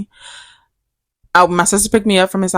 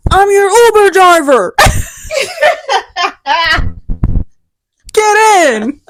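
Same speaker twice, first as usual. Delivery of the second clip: much higher, loud put-on driver voice with a laugh; mock Uber driver; 13 semitones higher, 4 dB louder, slower than usual. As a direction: play a cheery driver picking up a rider, laughing. Joking with sister at driveway.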